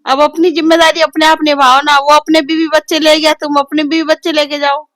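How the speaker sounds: wife lecturing her husband loudly; heated scolding. Real, not acted. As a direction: push him to take responsibility. Wife argues louder.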